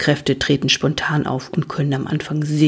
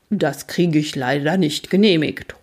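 ordinary delivery

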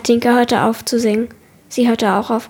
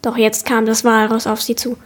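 usual speaking voice

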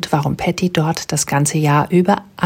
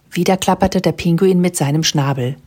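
plain reading voice

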